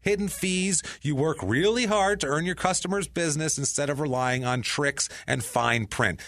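lively scripted ad delivery; ad read. Real, not acted. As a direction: sell it with emphatic podcast-host energy. Host reads sponsor ad.

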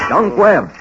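loud call to wake him. Acted. Doctor calls his patient's name loudly to wake him. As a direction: raise the voice to rouse a dazed man. Calling out to unconscious Dunk.

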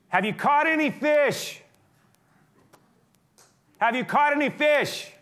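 high, loud call across the water. Acted. Jesus calling out from the beach to the fishermen.

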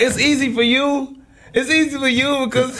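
high, pleading protest; mock protest. Answering his fiance's teasing, high mock protest. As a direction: push back at her, half joking. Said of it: "I'm like".

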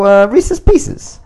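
put-on drawn-out, high Italian accent on Reese's Pieces. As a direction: stretch it out in a hammy Italian accent. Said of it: "now I'm doing, uh, the Italian accent still".

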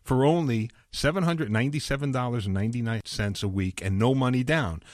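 upbeat radio ad read. Acted. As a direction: pitch it like a sponsor spot. Host reads live sponsor ad.

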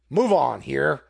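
loud, rough order to the crowd; savage tone. A narrator's reading. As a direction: bark it at the crowd. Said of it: "in a very savage tone".

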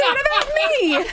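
high, slow, laughing whine; laughing complaint. Real, not acted. Left out of the round, asking the boys while laughing.

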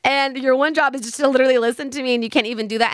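lightly imitated exasperation, pitch jumping up on the second I'm paying you; a mock fed-up client complaining; annoyed friend. Friend telling the therapist she pays her, mildly annoyed. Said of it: "my friend was already kind of annoyed".

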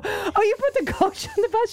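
high-pitched, laughing mock question; amused teasing. Daughter teasing her mom about the coach, laughing.

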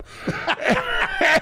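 high-pitched burst of laughter; real high squeal of laughter. Real, not acted. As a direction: crack up at your own punchline. Laughing at own punchline.

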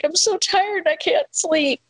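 high-pitched mock whine; imitated crying child. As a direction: mimic an overtired kid whining at bedtime. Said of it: "came in and was crying".

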